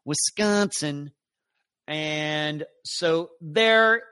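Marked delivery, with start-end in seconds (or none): 0.0-1.1 Wisconsin slow and stretched, upper Midwest accent. Shown as a demonstration. Host correcting the usual way of saying it, upper Midwest accent. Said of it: "Not Wisconsin".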